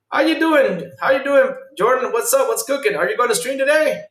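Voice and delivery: high, loud, bubbly hello; sing-song hype welcome to chat; excited greeting. Real, not acted.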